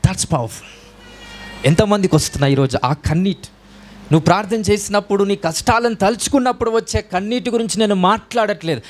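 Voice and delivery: ordinary talk, nothing marked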